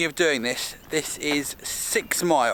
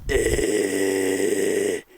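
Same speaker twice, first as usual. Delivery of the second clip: low, ominous movie-title voice; horror trailer voice; 8 semitones lower, much slower than usual. Pretend. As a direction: announce it like a horror film. Horror film set, movie title.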